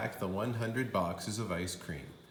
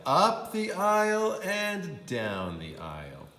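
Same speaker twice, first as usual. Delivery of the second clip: higher, slower, drawn-out last repeat; storyteller's slow, emphatic third time; climax of repetition; 8 semitones higher, 4 dB louder, slower than usual. A narrator's reading. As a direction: stretch the final round up and down the aisle.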